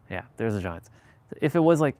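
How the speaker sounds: ordinary talk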